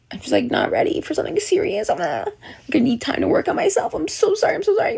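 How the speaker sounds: high, mocking sing-song apology; mocking whiny excuse. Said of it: "they're like".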